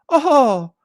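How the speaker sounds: very high, slow cutesy mock Japanese accent. Mimicking opponents playing small, mocking Japanese-accented voice. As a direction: whine helplessly in a caricatured Japanese accent. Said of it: "I don't know why it became Japanese".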